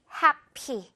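happy with a hard puff of air on py; both syllables of happy given full force; overdone aspirated p. Retold or imitated. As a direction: push out a strong 피 in happy. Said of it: "이렇게 열심히 피읍 소리 내려고".

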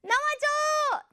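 high, drawn-out sing-out call; playful calling out. Pretend. Teacher summons the first word to appear, playful high call. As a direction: call it out high, stretching the syllables.

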